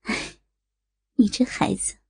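soft sigh, then a gentle murmur; wistful sigh. Acted. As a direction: let it out as a quiet lament. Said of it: "发出一声感叹".

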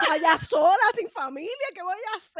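loud, high-pitched melodramatic wail; mock panic. Friend imagines being alone without family, loud mock panic. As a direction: cry it out high and loud, hamming it up.

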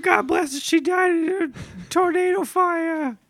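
high goofy voice. Acted. Frank voice continues.

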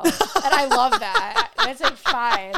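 giggling at herself; laughing. Real, not acted. Guest laughing about the story she never told.

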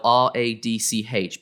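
R A D C H said letter by letter; spelled out. Shown as a demonstration. Podcast guest spells an unfamiliar book title, letter by letter. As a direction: spell out R A D C H as separate letter names. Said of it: "It's spelled".

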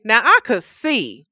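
self-righteous boast. Retold or imitated. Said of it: "how often do we say".

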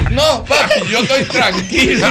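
high, slow mock-tearful reassurance; imitated crying child. Crying boy reassuring his father, high and mock-tearful. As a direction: insist you are fine through fake sobs. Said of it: "Los dos llorando".

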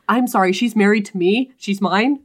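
loud mock possessive husband; possessive interruption. Husband interrupting the doctor to claim his wife, mock possessive. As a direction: butt in with hammed-up, forceful ownership.

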